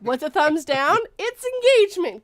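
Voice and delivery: gleeful quiz. Retold or imitated. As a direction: crow the answer to your own question. Gleeful quiz, answering own question.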